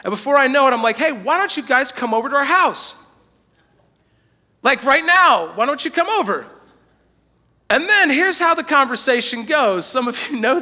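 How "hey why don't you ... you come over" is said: higher, keen, all-in offer; eager invitation. Retold or imitated. Husband eagerly inviting friends to his house, excited. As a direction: sell it like the best idea ever.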